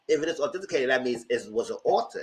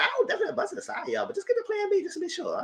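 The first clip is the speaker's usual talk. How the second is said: a laughing, sarcastic imitation of his excuse; high fast mock voice; mocking the guy; 5 dB quieter, much faster than usual. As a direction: rattle it off fast in a high squeaky put-on voice. Mocking Chris's text.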